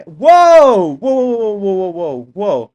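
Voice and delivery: loud drawn-out whoa, then rapid whoas; real startled shout of whoa; startled yelling. Real, not acted. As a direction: yell in real surprise. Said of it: "Sorry to yell in your ears".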